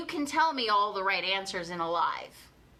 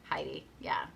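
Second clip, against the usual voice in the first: whispered aside; 6 dB quieter than usual. Real, not acted. Aside to someone off mic.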